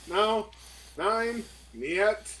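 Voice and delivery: ordinary talk, nothing marked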